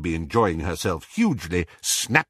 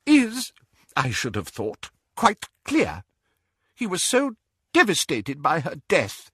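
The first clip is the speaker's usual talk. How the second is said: halting, higher character voice, holding back feeling; stiff with emotion; 7 semitones higher than usual. A narrator's reading. Elphias speaking of Ariana's death, stiff with emotion. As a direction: speak stiffly through mild emotion, with breaks. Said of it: "in a voice stiff with emotion".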